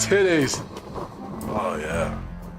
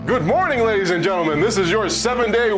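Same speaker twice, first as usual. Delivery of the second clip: announcer voice; 3 semitones higher than usual. Acted. Weathercast intro retake.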